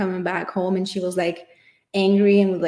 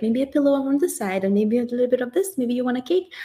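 higher-pitched, fussing helper; over-eager caretaker; 5 semitones higher, faster than usual. Acted. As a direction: fuss over a partner, offering anxiously in a high voice. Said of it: "me trying to be like".

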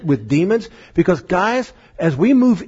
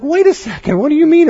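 high, louder mock protest; indignant disbelief; 10 semitones higher, 5 dB louder than usual. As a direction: bristle back at the rebuke, pitched up. Apostles indignant at Jesus.

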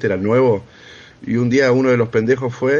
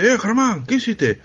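mock angry; 10 semitones higher, slower than usual. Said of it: "vino así enojado a mí".

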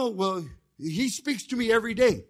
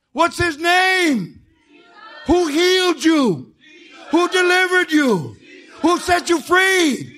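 high, loud, slow preacher's shout; preaching at full force; 9 semitones higher, 8 dB louder, slower than usual. Acted. Preacher rousing a sleepy congregation, slow booming shout. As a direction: thunder it at the pews, drawn out.

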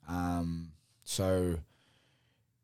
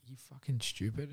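inner critic voice; 6 semitones higher, 4 dB quieter than usual. Retold or imitated. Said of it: "the head noise was like".

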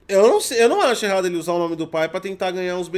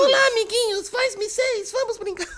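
high sweet childish cartoon voice; squeaky kids' show host greeting; cartoon princess; 13 semitones higher, slower than usual. Mocking the president's son's videos.

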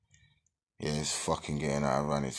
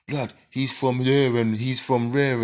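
roadman shout; 10 semitones higher, 10 dB louder than usual. Retold or imitated. Acted quote of men at a dance.

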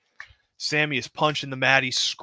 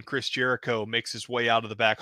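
ordinary talk, nothing marked

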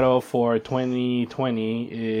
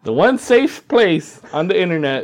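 announcer sign-off; 5 semitones higher, 5 dB louder than usual. Pretend. Hammy podcast sign-off plug.

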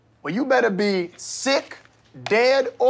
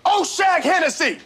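angry shout; 9 semitones higher than usual. Acted. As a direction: keep yelling the name. Teacher still shouting.

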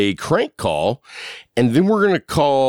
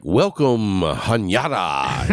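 slow, syrupy, overly welcoming greeting; mock welcome sign; 3 semitones lower, much slower than usual. Mocking other states' greetings, slow syrupy welcome. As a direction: drawl it like a friendly state sign. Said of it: "everybody else's state's like".

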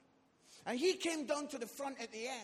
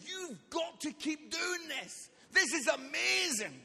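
higher, drawn-out gushing praise; amazed leader; 4 semitones higher, 4 dB louder than usual. Retold or imitated. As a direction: imitate an enthusiastic convert to the show. Leader amazed at the later show.

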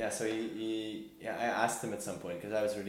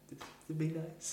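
higher, sing-song mock innocence; mock innocent; 9 semitones higher than usual. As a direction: play it sweet and innocent, teasing yourself.

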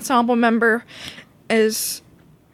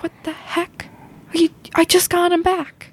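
breathy start, then high-pitched protest; mock exasperation; 8 semitones higher than usual. Child voicing Eliza upset at losing husband, mock exasperated. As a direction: complain in playful disbelief.

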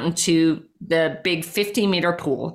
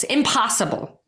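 normal talking voice

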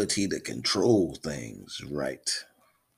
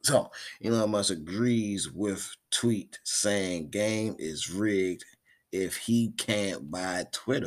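slow mock newsreader delivery; slow headline reading; news read; 4 semitones higher, slower than usual. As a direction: read the headline slowly like an anchor. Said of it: "I'm reporting the news".

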